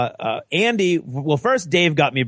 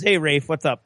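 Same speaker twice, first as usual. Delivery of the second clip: ordinary talk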